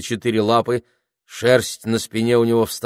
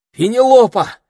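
high, loud startled cry of a name; a boy's startled shout; exclaiming; 11 dB louder than usual. A narrator's reading. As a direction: call out her name in shock. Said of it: "воскликнул Питер".

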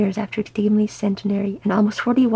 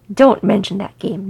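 louder, curt snap; snapped; 6 dB louder than usual. A narrator's reading. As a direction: cut the subject off sharply. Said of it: "Nodi snapped".

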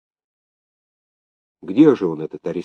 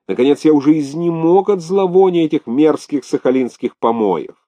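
pitched-up, fed-up protest; exasperated outburst; 8 semitones higher, 4 dB louder than usual. Acted. Governor exhausted by Sakhalin filth and gossip, exasperated. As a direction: burst out at the end of your patience.

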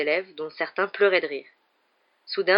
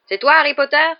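loud, high call rising at the end; raised voice, demanding who it is; shouted question; 9 semitones higher, 11 dB louder than usual. A narrator's reading. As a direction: cry it out over a crowd. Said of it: "cria-t-il".

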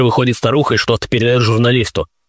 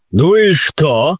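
put-on Yorkshire accent; 5 semitones higher, much slower than usual. A narrator's reading. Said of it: "с сильным йоркширским говором спросил".

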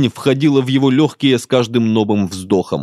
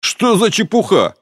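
high, exasperated outcry; exclaiming; 7 semitones higher than usual. A narrator's reading. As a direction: exclaim in disbelief. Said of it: "воскликнул Жан".

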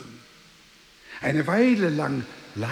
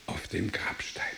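whisper; 7 semitones lower, 9 dB quieter than usual. Acted. Speaks of his gravestone.

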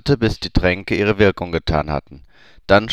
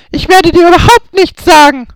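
put-on much higher, louder young woman, spat out; Hermine spitting; 8 dB louder than usual. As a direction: spit the refusal at him. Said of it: "spie Hermine".

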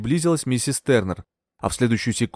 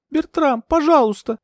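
very high, imploring cry; high-pitched entreaty; pleading; 4 dB louder than usual. A narrator's reading. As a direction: beg him, high and urgent. Heroine begs Bertram.

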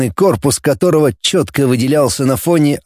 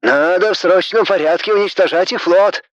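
belligerent; 5 semitones higher than usual. Acted. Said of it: "развоевался Григорян".

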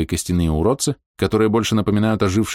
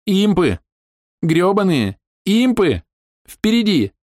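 panicked high shout; 12 semitones higher, 3 dB louder, slower than usual. Acted. Panicked companion Maus.

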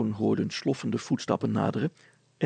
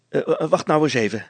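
louder, higher, quick exclamation; sudden call; 4 semitones higher, 7 dB louder, faster than usual. A narrator's reading. Father pacing hurriedly suddenly calls out to his son, raised voice. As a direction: burst in suddenly to halt the other. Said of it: "plotseling riep hij".

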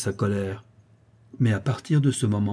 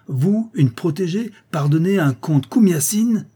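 enraged disbelief; 8 semitones higher, 6 dB louder than usual. Acted. Young count scorning a mere protégée, enraged. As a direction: spell out the insult with rising indignation. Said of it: "blême de rage".

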